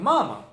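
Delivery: Russian मामा, strong first मा and weak second; mama as Russians say it, stressed at the start; first-syllable stress. Shown as a demonstration. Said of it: "Russians ऐसे बोलेंगे".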